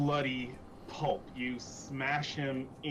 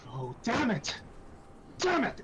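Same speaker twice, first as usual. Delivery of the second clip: frustrated outburst; 9 semitones higher than usual. Acted. Iku curses over the frozen friend, absolutely frustrated. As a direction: pitch leaping high on the repeat. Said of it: "she just seems absolutely frustrated".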